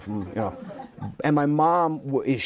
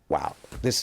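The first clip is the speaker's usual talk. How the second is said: overcome with emotion; 8 semitones lower, 4 dB quieter than usual. Retold or imitated. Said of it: "I had to fight back getting emotional".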